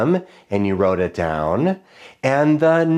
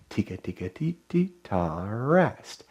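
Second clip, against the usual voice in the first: much softer rhythm echo; 2 semitones lower, 8 dB quieter than usual. Shown as a demonstration. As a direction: quietly echo "Tika, tika, teet, teet, ta, rest". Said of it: "class echo".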